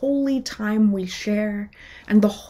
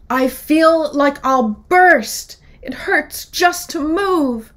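slow, pained, louder wolf moan; groaning wolf; 6 semitones higher, 7 dB louder, slower than usual. Acted. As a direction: drag it out in pain. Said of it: "the wolf moan".

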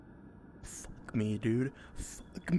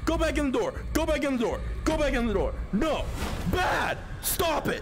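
frantic scolding; 9 semitones higher than usual. Real, not acted. Player yells at the slamming door, frantic. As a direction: shout at it like a naughty dog.

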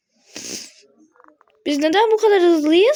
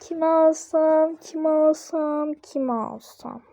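musing aloud; much slower than usual. Real, not acted. Gamer wondering which character to pick, slow musing. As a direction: mull it over slowly.

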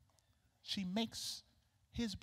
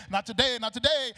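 louder spoken interjection over a praise song; jubilant ad-lib; 8 semitones higher, 8 dB louder than usual. Acted. Celebrating that nothing is taken today, jubilant ad-lib. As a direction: throw it in loudly between sung lines.

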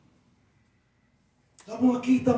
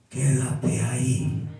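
low, slow, solemn divine command; voice of God; 9 semitones lower, much slower than usual. Retold or imitated. Preacher voices God telling him to stay, low and slow. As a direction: intone it like God speaking from above. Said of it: "Pero Dios me dice".

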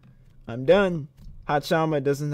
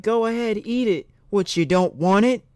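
higher-pitched mock urging; imagined pushy hostess; 7 semitones higher than usual. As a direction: coax a guest in a raised voice. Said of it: "And she's like".

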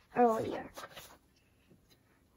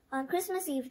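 plain reading voice

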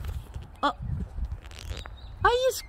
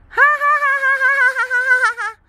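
loud, bursting ha-ha laughter; real hearty laugh at a pet; 3 semitones higher, 4 dB louder, faster than usual. Real, not acted. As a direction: laugh out loud at the funny bird. Laughing back at bird.

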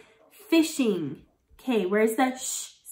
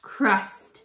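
normal talking voice

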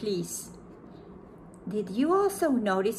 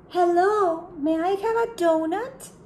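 higher, louder polite ask; polite animal; 8 semitones higher, 4 dB louder than usual. Acted. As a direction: ask sweetly and politely, high.

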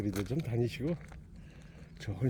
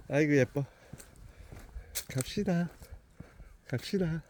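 talking to a dog; 5 semitones higher than usual. Real, not acted. Praising and leading the dog.

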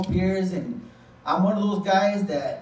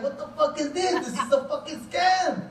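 can't believe what he's seeing; high-pitched laughing "what the fuck"; shocked disbelief; 8 semitones higher than usual. Retold or imitated. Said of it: "I was like".

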